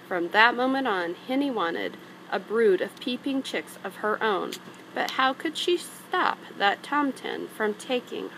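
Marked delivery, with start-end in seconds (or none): none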